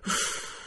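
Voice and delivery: noisy breathless puff of air; loud exhale. Acted. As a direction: blow the breath out hard. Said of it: "بازدمش را پرصدا بیرون داد". (بازدم پرصدا)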